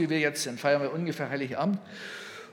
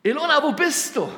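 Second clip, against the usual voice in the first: high, loud call by name; 9 semitones higher, 6 dB louder than usual. Acted. Preacher voices the divine call to his wife, calling out. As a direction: call her as if from afar. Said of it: "Später kam der Ruf".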